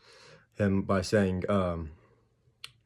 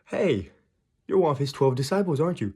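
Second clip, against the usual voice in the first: higher, questioning accuser voice; woman accusing; 5 semitones higher than usual. Retold or imitated. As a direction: imitate a woman calling someone out. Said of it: "and the woman goes".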